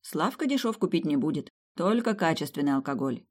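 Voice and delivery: ordinary delivery, nothing marked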